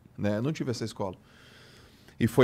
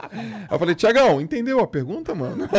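laughing through the retold reply, louder and higher; half-laughing as he quotes himself; 8 semitones higher, 6 dB louder than usual. Real, not acted. Telling friends the story, laughing as he speaks.

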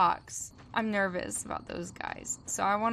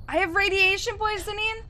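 panicked; 10 semitones higher, 6 dB louder than usual. Real, not acted. Panicked gamer status.

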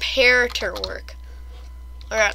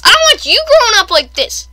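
loud, high-pitched telling-off; mock scolding; 10 semitones higher, 10 dB louder than usual. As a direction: scold loudly in mock outrage. Mock parental scolding escalates.

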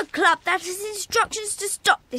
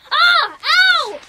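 high sharp yelps of pain; cries of pain; 8 semitones higher, 5 dB louder than usual. Acted. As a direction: yelp as you're grabbed in the fight. Fight, getting hurt.

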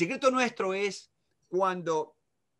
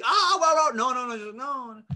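high, loud burst; exaggerated outburst tailing off; acted over-the-top reaction; 6 semitones higher, 5 dB louder, slower than usual. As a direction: play a dad reacting too big. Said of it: "él capta que yo no estoy".

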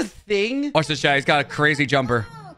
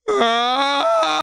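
imitated yell; 8 semitones higher than usual. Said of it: "There's literally someone going".

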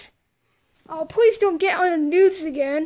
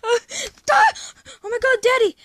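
excited cry; 6 semitones higher, 5 dB louder, much faster than usual. Acted. Waking up, calling to dad.

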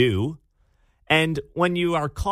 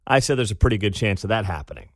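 ordinary delivery

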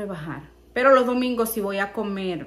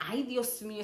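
ordinary delivery

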